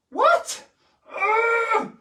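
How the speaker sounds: high, loud squeal of protest; mock outrage. Telling viewers off for moving it early, high squeal. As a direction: play shocked it moved too soon.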